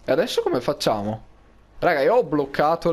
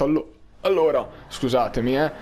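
with hiccups; 4 semitones lower than usual. Real, not acted. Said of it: "Singhiozzo, saltellante ogni tanto".

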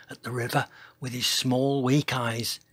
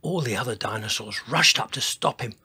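ordinary delivery

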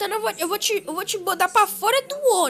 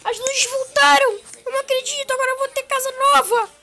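high, loud, overjoyed; girl thrilled to see her new home; excited cry; 7 semitones higher, 5 dB louder than usual. Acted. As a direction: burst out with delight at the house. Girl finds her new house.

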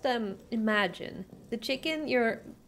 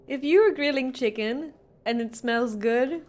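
usual speaking voice

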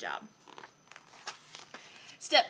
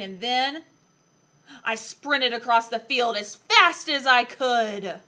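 loud, punchy story reading; excited story climax; 12 dB louder, slower than usual. A narrator's reading. Reader telling the pounce on the dog, loud excited storytelling. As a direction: build the action with big emphatic beats.

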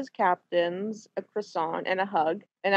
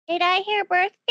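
high, louder excited question; eager echo; 12 semitones higher, 5 dB louder than usual. Acted. Apollos echoing the friar with an eager gleam. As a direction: pounce on the word birthday. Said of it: "gleam in her eye".

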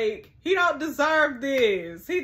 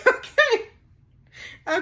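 bursting into high laughter on Okay; laughing out loud; 11 semitones higher, 6 dB louder than usual. Real, not acted. Cracking up at her own misread reply, laughing. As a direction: laugh it off, then land on Okay.

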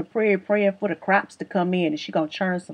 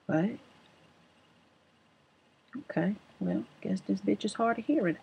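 mimicking her muttering; 10 dB quieter than usual. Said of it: "she goes".

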